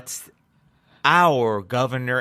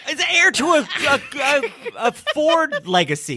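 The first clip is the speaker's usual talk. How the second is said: mock herald; 10 semitones higher, faster than usual. Mock grand announcement of heiress.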